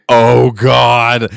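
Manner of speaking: dread hitting. Retold or imitated. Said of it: "I was like".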